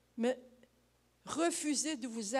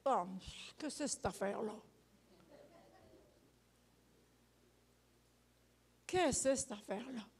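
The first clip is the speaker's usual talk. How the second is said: grumbling annoyance; 4 semitones lower, 6 dB quieter, faster than usual. Retold or imitated. Annoyed person disturbed at home.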